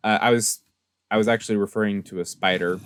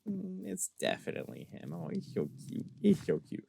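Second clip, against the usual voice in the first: much softer, half-whispered gushing; cooing over cute; 8 semitones higher, 13 dB quieter than usual. Retold or imitated. Spotting the ghost's gift axolotl, a soft whispered coo. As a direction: coo like you're cradling a pet.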